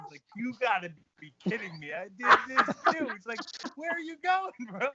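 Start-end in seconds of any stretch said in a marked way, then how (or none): 0.4-3.1 high, loud mock disbelief; hammed-up incredulous outburst; incredulous doctor. Doctor exasperated at returning patient. 3.8-4.9 high-pitched mock exasperation; hammed-up baffled question; incredulous doctor. Doctor reacting to returning patient.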